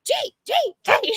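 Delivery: squeaky high gee sounds; goofy high-pitched sounds; high silly voice. Pretend. Silly high sung noises.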